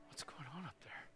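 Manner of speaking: very soft mock whisper; barely audible, as a woken passenger; hushed murmur. Business passengers waking up front, hushed murmur. Sleeping passengers wondering at the commotion.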